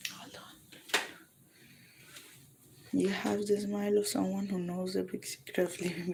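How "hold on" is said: unvoiced soft whisper. Real, not acted. Pausing the livestream in a whisper. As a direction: breathe it without voice.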